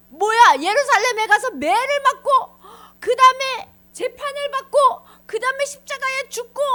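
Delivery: high, loud, acted alarm; hammed-up stunned disciple; shocked disbelief. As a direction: repeat the terrible news aghast.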